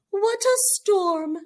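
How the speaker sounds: high, loud chirpy bird voice; twittering bird. Acted. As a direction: chirp it like a little wren. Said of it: "twitters wren".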